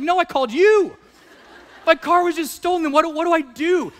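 high, laughing, frantic; husband to wife on the phone, panicking; retold panic. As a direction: play your own helplessness. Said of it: "I was like".

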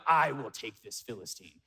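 preacher mocking our imagined bravado as David; mock heroic boast. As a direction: play the brave warrior tongue in cheek. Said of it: "we would walk up and be like".

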